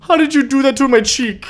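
high, put-on shocked voice; mock Lionel. Lionel shocked at his cut cheek, high voice. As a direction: gasp it out high.

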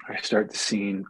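usual speaking voice

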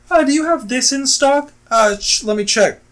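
hammed-up store clerk putting a caller on hold; mock clerk on phone. Retold clerk phone routine.

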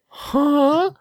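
very high puzzled Piggy huh; Piggy's confused huh. Retold or imitated. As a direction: squeak a baffled huh. Piggy looking around confused.

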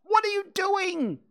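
high panicked scream. Acted. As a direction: scream at him in alarm. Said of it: "screamed again".

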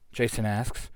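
ordinary delivery, nothing marked

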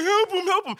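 high-pitched mock-crying appeal; hammed-up tearful pleading; crying plea. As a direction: imitate your panicked younger self begging. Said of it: "I said, I'm, I'm crying".

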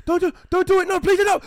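high, loud frantic begging; hammed-up panic, pleading to stop; panicked pleading. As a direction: shout a desperate warning. Acting panicked warning over radio.

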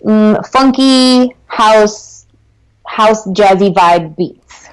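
drawn-out, relishing each word; slow and dreamy; slow savoring. Real, not acted. Savoring the words slowly.

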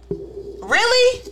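high incredulous "Really?". Real, not acted. Incredulous reaction.

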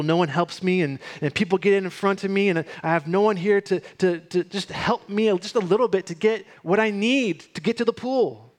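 mildly acted helpless complaining; listing excuses. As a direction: act out a man piling up excuse after excuse. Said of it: "starts listing off these excuses".

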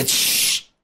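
shushing. Retold or imitated. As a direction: hush him like the guard did. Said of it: "cómo me hacía". (siseo para callarlo)

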